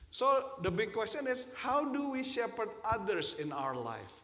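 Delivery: loud, slow and weighty; preaching at full force. Acted. Pastor putting the key question to the congregation, loud and slow. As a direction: lean into the big question.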